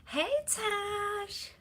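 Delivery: high, sing-song coo to a cat. Real, not acted. Cooing to the cats.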